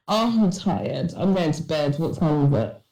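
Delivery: ordinary talk, nothing marked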